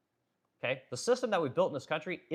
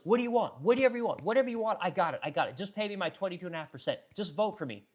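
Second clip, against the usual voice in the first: mock drug dealer pitch; 5 semitones higher, 3 dB louder than usual. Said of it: "They're like drug dealers".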